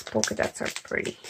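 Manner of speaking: soft unvoiced whisper. Real, not acted. Unboxing late at night while everybody sleeps, whispering. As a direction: breathe it so nobody wakes. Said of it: "I've been a little bit quiet".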